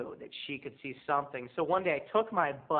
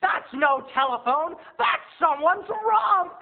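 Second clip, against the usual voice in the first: loud, high, slow drawn-out outcry; shocked uncle exclaim; 13 semitones higher, 9 dB louder, slower than usual. Retold or imitated. Uncle realizing what the photo really shows, loud slow outcry. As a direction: bellow the discovery in shock. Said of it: "my uncle goes".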